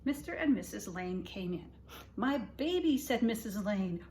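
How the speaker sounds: gentle read-aloud for little listeners; storybook reading. A narrator's reading. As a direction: read it like bedtime story time. Reading picture book to kids.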